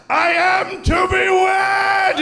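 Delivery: high, drawn-out mock-heroic shout; triumphant proclamation. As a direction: proclaim it slowly at full voice. Acts out triumphant wedding announcement.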